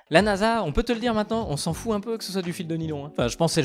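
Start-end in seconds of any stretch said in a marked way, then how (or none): none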